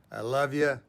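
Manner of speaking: ordinary delivery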